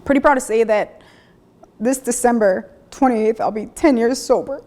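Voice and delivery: real emotion welling up, trying not to cry; proud words through held-back tears; holding back tears. Real, not acted. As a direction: voice catching with emotion, fighting tears. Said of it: "try not to cry here".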